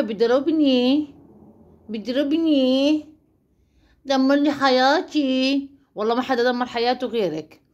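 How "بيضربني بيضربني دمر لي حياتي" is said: slow, hammed-up whining; mock wailing. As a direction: imitate her crying, dragging each word. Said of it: "تعيطي وتنوحي وتجوحي". (نواح تمثيلي ساخر وبطيء)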